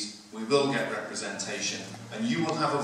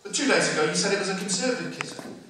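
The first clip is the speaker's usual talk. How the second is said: indignant protest; 7 semitones higher, faster than usual. Retold or imitated. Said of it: "George says".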